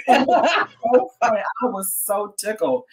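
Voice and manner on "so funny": slow, high laugh through words; giggling it out. Real, not acted. Laughing at own story.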